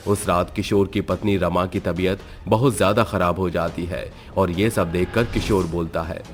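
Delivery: ordinary delivery, nothing marked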